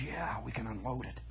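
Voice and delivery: soft conspiratorial whisper. Retold or imitated. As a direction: keep your voice down, sly. Secret deal whispered in study hall.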